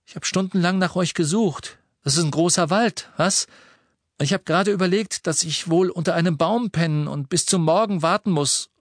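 put-on lively teenage boy voice; Ron's voice. A narrator's reading. Ron voiced in story. (höhere Jungenstimme für Ron)

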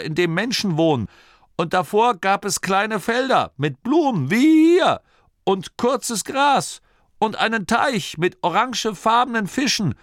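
put-on high, excited old storyteller; insistent old man. A narrator's reading. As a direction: tell it high and eagerly, determined to be believed. Old Torrid insists on his tale. (aufgeregter Alter, hohe Stimme)

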